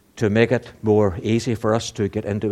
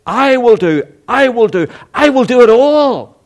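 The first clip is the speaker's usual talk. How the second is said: each "I will do" climbs higher and louder; building declaration; 11 semitones higher, 6 dB louder than usual. Acted. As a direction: build it up to a proclamation. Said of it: "God says".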